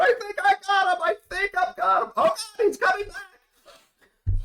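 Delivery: high, frantic cry of alarm; frightened yelling, high pitched; panicked NPC. Acted. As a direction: shout it like he's under fire.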